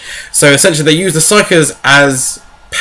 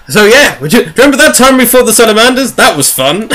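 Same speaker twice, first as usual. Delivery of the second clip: higher, fast, breezy mock chat; casual reminiscing; 8 semitones higher than usual. Marines chatting about fighting the Salamanders, in breezy mock chat. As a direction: toss it off like old war buddies. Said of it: "'Cause they're like".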